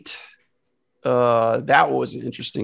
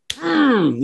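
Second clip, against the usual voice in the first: satisfied hum; 12 semitones higher, 4 dB louder than usual. Pretend. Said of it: "You wanna".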